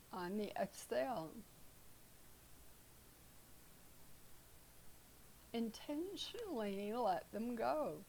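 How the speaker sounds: calm, unhurried meditation guide with long pauses; guided meditation. Real, not acted. Teacher leading students through breath awareness, guided meditation. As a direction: ease them into stillness, leaving space between phrases.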